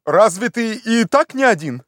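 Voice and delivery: high, indignant questioning; impatient demand. Acted. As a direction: demand an explanation from below. Master baffled and impatient.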